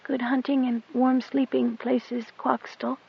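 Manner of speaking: put-on high, soft girl's whisper under her breath; whispered back. A narrator's reading. Goat Dung whispers her goodbye to the cat, high and soft. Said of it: "she whispered back under her breath".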